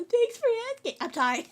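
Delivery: usual speaking voice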